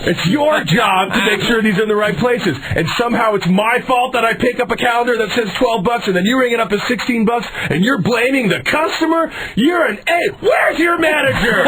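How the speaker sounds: high, strained shouting that keeps climbing; high-pitched indignant tirade; acted furious rant. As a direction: re-enact a customer meltdown, building to a screamed demand. Acted outraged quarrel with store employee.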